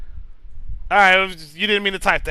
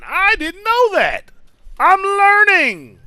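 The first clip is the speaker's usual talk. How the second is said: higher, slow, put-on innocent voice; mock naive voice; 8 semitones higher, slower than usual. Streamer mocking a chat fact in a faux-innocent voice. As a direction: play dumb, stretch it out sweetly.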